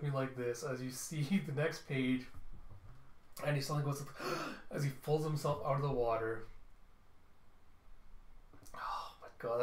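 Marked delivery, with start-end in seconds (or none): 4.1-4.6 sharp gasp. Acted. Character surfaces from water. 8.7-9.6 breathless whispered awe; stunned whisper. Real, not acted. Reader shocked at cliffhanger.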